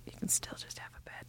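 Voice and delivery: retold whispered retort, a bit fast; sly whispered comeback. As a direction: hiss it back under your breath at the screen. Retelling own reply to character.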